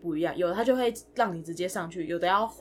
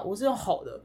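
ordinary delivery

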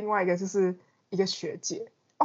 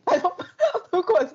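laughing; 11 semitones higher, 4 dB louder than usual. Real, not acted. Laughing plea.

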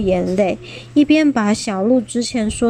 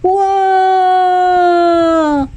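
拖长的高声惊叫; 跌落时吓得大叫; 8 semitones higher, 5 dB louder than usual. Acted. 霸王龙掉下山. (长长的高声惊叫)

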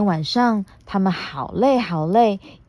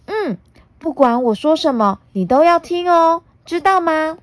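put-on bossy little girl; 8 semitones higher, 3 dB louder than usual. Puna bossing the T-rex.

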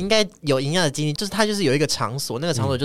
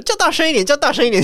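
much higher, louder jeering call from next door; mock shouting; 12 semitones higher, 5 dB louder than usual. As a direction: ham up a crude shout through the wall. Mocking voices heard in dark room. (模仿外面的大陆人起哄，高声大喊)

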